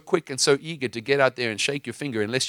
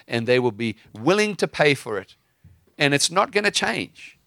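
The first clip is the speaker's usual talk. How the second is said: preaching with force; 3 semitones higher, 3 dB louder than usual. Acted. Preaches at full force to caller.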